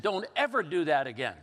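hammed-up telling-off over the phone; imitated scolding. Sister-in-law scolds him, retold.

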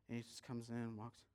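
usual speaking voice

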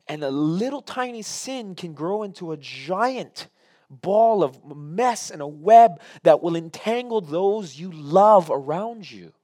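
loud, slow, rising preacher's warning; preaching at full force. Acted. Pastor warning the church about sin, loud slow preaching. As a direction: build it big and slow over the church.

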